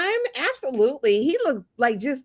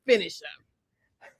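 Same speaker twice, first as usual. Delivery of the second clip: villain catchphrase; 6 semitones lower, 6 dB quieter, much slower than usual. Pretend.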